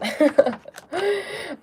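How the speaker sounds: bright high burst of laughter. Real, not acted. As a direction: laugh it off, amused at herself. Laughing at her own accent.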